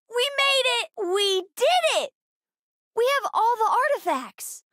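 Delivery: high, excited celebration; triumphant cheer. Acted. Game character celebrates a success.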